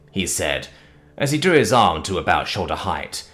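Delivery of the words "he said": plain reading voice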